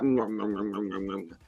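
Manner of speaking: low, quiet satisfied humming, fading out; contented mm after dinner. Retold or imitated. Imitating a viewer's satisfied post-dinner mm, low and fading. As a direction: savour a good dinner. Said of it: "He's like".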